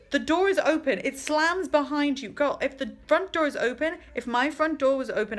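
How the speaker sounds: usual speaking voice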